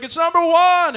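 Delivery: very high, loud group-style yell; crowd shout. Retold or imitated. Stadium crowd answering the host, high full-volume shout. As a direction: holler the answer like a fan.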